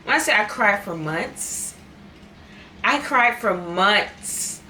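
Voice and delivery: slow, drawn out, stressing months; slow earnest emphasis on cried for months; emphatic. Real, not acted. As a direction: lean on every word. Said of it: "I said months".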